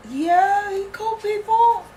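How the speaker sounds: high, eager insistence. Real, not acted. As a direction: vouch for him eagerly. Player defends character.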